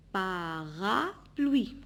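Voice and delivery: "Parapluie" very slow, drawn into pa, ra, pluie; syllable by syllable. Shown as a demonstration. Teacher repeating the word for pupils, syllable by syllable. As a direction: draw out each of the three beats in "Parapluie". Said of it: "Trois syllabes".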